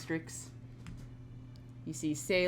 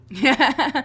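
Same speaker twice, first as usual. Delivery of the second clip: laughing; 6 semitones higher, 10 dB louder than usual. Real, not acted. Laughing reply.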